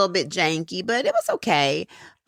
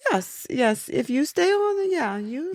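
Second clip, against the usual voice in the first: put-on light South African accent, higher and measured; a hint of South African lilt, gently correcting; 5 semitones higher than usual. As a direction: mimic a polite South African professor. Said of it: "the South African accent".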